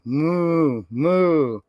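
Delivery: long, slow, low moo; cow mooing. Acted. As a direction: draw out a cow's moo. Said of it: "He can sound like a cow".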